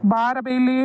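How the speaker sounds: cross holler to come here; calling out. Acted. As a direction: summon your mother from another room. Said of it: "ಯಾಕ ಆ ನಮೂನಿ ಹೊದ್ರಾಕತ್ತಿದ್ದಿ?". (ಸಿಟ್ಟಿನಿಂದ ಕೂಗಿ ಕರೆಯುತ್ತಾ)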